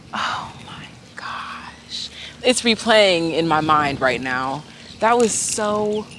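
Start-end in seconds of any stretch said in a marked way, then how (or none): 0.1-2.1 slow, breathless whispered oh my gosh; awed whisper. Real, not acted. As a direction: whisper it slowly in disbelief. Replaying the missed moment.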